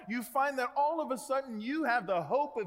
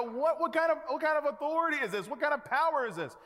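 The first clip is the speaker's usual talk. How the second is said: higher, louder astonished questions; amazed disbelief; 7 semitones higher, 4 dB louder, faster than usual. Retold or imitated. Congregation imagining the disciples' reaction, astonished and louder. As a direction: voice the crowd's stunned wonder. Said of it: "we look at that and we go".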